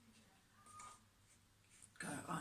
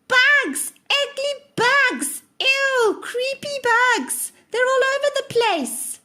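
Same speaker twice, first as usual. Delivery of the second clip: high, loud, drawn-out scream of disgust; 20 dB louder, slower than usual. Acted. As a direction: shriek it in horror at the bugs. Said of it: "she began to scream".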